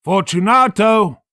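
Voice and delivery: high, loud call into the niche; calling aloud. A narrator's reading. Montresor calling to Fortunato through the wall, loud call. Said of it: "I called again".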